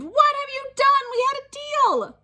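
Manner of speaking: very high, loud outraged yell; furious. Acted. Mom yelling at Spencer about broken deal, very high loud. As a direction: blow up at him.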